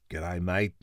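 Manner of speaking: a light Australian twang on g'day mate; quick mock Aussie greeting. Voice actor joking nobody hears Australian in him, light Aussie accent. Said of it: "no one ever says anything along the lines of".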